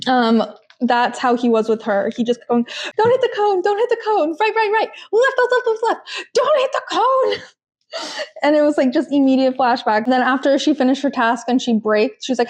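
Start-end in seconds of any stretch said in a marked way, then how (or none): 3.0-7.3 high, urgent repeated commands; hammed-up panicked instructor; mock bossy coaching. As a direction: bark directions like a frantic driving coach. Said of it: "it would always get really loud and aggressive".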